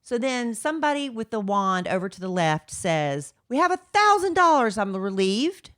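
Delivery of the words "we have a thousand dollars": high, loud auctioneer call; mock auctioneer announcing the bid.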